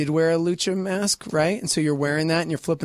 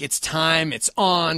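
usual speaking voice

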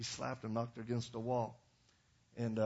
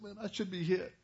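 mock wimp, pitched higher; whiny wimp; 6 semitones higher than usual. A wimp blaming himself to his counselor, whiny. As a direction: imitate a whimpering confession. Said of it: "he's just a wimp".

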